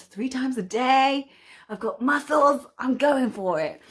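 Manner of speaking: loud high proud cheer; excited boast. Real, not acted. Vlogger bragging to viewers about Zwifting, loud and high. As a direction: brag it out with glee.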